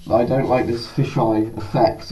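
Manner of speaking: usual speaking voice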